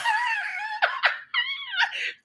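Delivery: helpless shriek of laughter; high shrieking laugh. Real, not acted. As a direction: burst into a high, squealing laugh. Bursts out laughing at tip.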